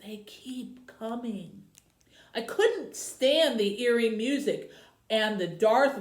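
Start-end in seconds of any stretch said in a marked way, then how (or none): 0.0-1.6 slow, much softer creepy ad voice; creepy low voice. Retold or imitated. Campaign ad announcer, slow and creepy. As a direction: drag it out low and eerie. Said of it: "a creepy low voice bellowed".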